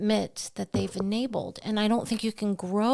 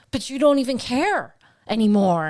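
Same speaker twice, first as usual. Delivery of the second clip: louder, higher hurt protest; louder, higher imitation of her son's accusation; teen son complaining; 4 semitones higher, 7 dB louder than usual. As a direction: push it out like a wounded teenager. Son's voiced complaint retold.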